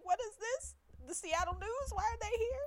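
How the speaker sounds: high, baffled questioning; mock surprise. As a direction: act out the confusion. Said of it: "I was like".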